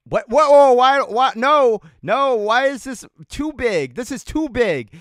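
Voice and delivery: high, loud disbelief; shocked outburst. Real, not acted. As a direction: react in sudden alarm. Startled by something on screen.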